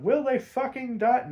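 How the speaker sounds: high, loud teasing cliffhanger question; mock cliffhanger. Real, not acted. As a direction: ham up the suspense.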